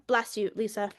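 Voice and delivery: normal talking voice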